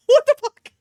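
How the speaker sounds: shrieking laugh, high and loud; laughing in disbelief. Real, not acted. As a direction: blurt it out mid-laugh. Shocked laugh at plot twist.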